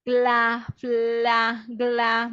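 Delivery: "gla", "fla" said slowly and clearly; cluster syllables. Shown as a demonstration. A teacher drilling GL and FL clusters, deliberate. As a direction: sound out each cluster.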